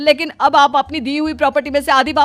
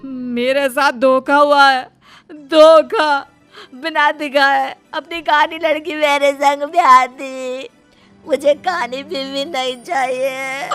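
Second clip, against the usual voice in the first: slow, hammed-up lament; drawn-out theatrical whine of betrayal; melodramatic wailing; slower than usual. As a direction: wail it out like a cheated groom. Groom wails that he was cheated.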